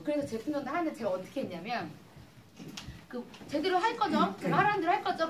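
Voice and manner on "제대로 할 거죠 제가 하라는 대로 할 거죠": retold pressing demand. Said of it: "그렇게 한대".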